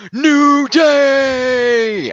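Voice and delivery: high, drawn-out wrestler's yell; hammed-up anguished holler; mock scream to the heavens. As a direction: shout it skyward, stretching both words. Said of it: "screams to the heaven".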